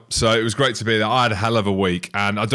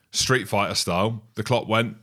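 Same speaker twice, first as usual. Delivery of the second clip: ordinary talk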